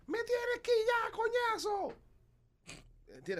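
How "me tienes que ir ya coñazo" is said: high-pitched, drawn-out mock shriek; imitating arguing girlfriend. Man imitating his Dominican ex-girlfriend in an argument, shrieked high and loud. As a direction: screech it like a furious girlfriend mid-fight. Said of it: "y cuando discutíamos".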